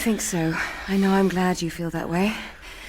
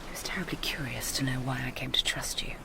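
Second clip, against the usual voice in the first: low hushed whisper, much softer; confiding whisper; 7 semitones lower, 9 dB quieter than usual. Acted. Telling her lover about the old man, hushed whisper. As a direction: whisper the secret.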